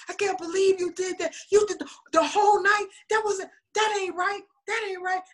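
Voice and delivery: high whiny imitated complaint; mock mad boyfriend. Boyfriend scolding the twins after the switch, mock high whine. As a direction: play the outraged boyfriend, half-laughing. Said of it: "they were so mad".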